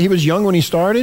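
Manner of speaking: mock disbelief, rising; incredulous repeat. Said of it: "Did you hear that?".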